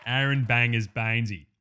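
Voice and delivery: cocky Aussie bloke hosting his own cooking show; ocker cooking host. Acted. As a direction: play a larrikin TV chef.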